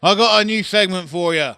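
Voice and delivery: imitated caller. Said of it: "And you said".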